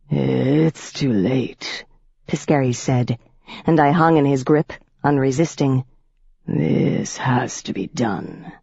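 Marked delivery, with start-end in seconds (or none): 0.1-1.8 lower, slow and cold vampire voice; a predator savoring every word; menacing old vampire. A narrator's reading. Piscary speaking. 6.4-8.5 lower, slow and cold vampire voice; a predator savoring every word; menacing old vampire. A narrator's reading. Piscary speaking.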